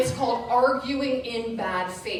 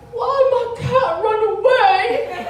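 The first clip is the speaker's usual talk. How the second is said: high, loud mock wail; hammed-up tearful little boy's cry; crying child; 11 semitones higher, 8 dB louder than usual. Four-year-old crying after his cat runs off, mock wailing. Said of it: "And he's crying".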